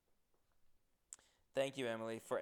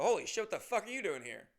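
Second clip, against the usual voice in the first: higher, louder amazed outburst; surprised greeting; 7 semitones higher, 4 dB louder than usual. Retold or imitated. Comedian reacting to a fan turning up, high and amazed. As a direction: blurt out disbelief. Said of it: "I go".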